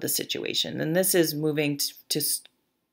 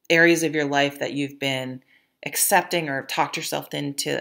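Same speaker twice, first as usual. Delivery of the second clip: ordinary delivery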